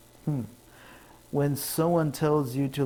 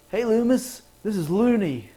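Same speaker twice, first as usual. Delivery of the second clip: much higher, louder cheeky call; prank caller; 8 semitones higher, 5 dB louder than usual. Acted. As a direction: greet them like a prankster.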